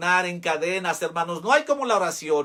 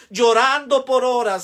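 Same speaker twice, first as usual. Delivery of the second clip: fervent outcry; 6 semitones higher, 6 dB louder than usual. Acted. Emotional peak of testimony prayer.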